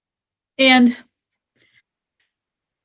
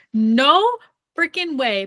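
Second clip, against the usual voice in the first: disbelief; 5 semitones higher, slower than usual. Retold or imitated. Bawling over reveal.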